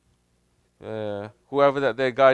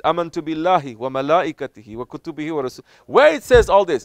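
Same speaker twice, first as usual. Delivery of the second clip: raised, louder scoffing doubter; mocking doubter; 6 semitones higher, 7 dB louder than usual. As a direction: play the smug objector, pitched up. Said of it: "they're going to say".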